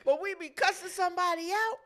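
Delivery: ordinary delivery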